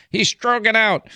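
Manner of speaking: high mocking voice; mocking critics. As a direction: mimic the critics, pitched high. Mocking critics' online comments.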